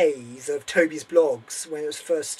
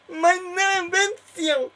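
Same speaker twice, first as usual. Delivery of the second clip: put-on much higher, slow, overjoyed German accent; German professor in raptures; 14 semitones higher, 5 dB louder, slower than usual. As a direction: drag out the words in mock ecstasy. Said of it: "I am Professor Von Strakontrek".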